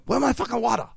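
put-on high-pitched Filipino-accented grumble; comic Filipino-accent aside; mock Filipino accent. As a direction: drop from singing into an imitated Filipino accent. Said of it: "until he has to talk".

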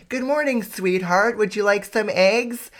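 mock saccharine morning greeting; Instagram-perfect wife. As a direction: gush like a picture-perfect wife. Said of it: "Instagram is like".

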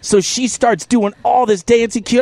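usual speaking voice

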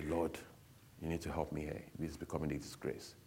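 low, soft plea to God; subdued prayer. Retold or imitated. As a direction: retell a humble prayer under the voice. Retells praying on his knees.

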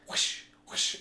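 two airy, voiceless whooshes; imitated whooshing. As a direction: swish of something rushing past. Imitates shadows rushing past.

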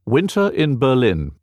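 ordinary delivery

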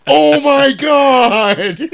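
loud, high, drawn-out wail; mock shock. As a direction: stretch it into a big exaggerated cry of disbelief. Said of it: "And I was like".

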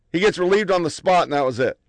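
usual speaking voice

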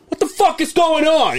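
high, loud, incredulous yell; mock yell. Confronting his ex-wife in the parked car, high mock yell. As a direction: shout it out like you caught them.

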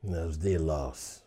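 low, slow, quiet acceptance; calmly write it off; resigned shrug. Acted. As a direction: let it go under your breath. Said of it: "or just saying, okay".

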